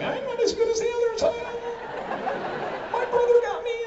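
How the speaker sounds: sheepish underdog pleading; a small, timid squeak of a meerkat; meek meerkat voice. Pretend. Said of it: "He's like".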